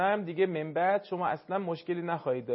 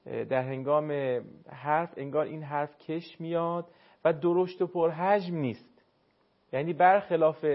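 usual speaking voice

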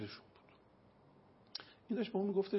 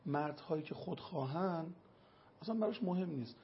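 ordinary talk

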